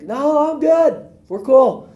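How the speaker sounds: very high, put-on carefree voice; cocky refusal. Man on his roof refusing the helicopter, very high carefree voice. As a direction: play it cool and smug.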